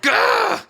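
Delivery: high shrieking cry; old bag shriek. Acted. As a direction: screech like an old hag. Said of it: "I'm being an old bag".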